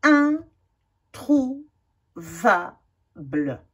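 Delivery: each word stretched very slowly, syllable by syllable; slow syllable reading. Shown as a demonstration. Teacher reading practice words slowly for children. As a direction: read the word as if decoding it slowly. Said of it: "slow decoding of word".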